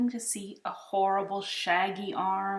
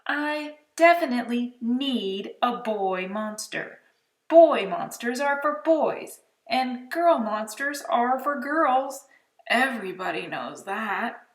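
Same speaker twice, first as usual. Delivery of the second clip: insistent; 4 semitones higher, 6 dB louder than usual. A narrator's reading. Boy insisting firmly.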